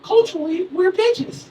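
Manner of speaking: put-on very high, assured kid voice; Tommy. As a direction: imitate a first-grader explaining earnestly. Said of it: "He's like".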